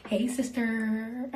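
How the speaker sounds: drawn-out "Hey, sister" with a squeaky laugh; high squeal of a laugh after a slow sassy hello; playful sassy greeting. Pretend. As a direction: greet him in a campy, teasing way. Greeting James Charles playfully.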